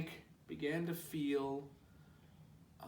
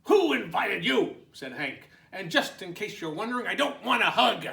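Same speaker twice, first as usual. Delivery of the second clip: 1 grumpy retort; 5 semitones higher, 9 dB louder, faster than usual. Acted. Grumpy Hank.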